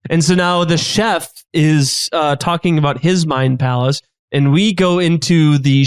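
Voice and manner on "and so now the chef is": usual speaking voice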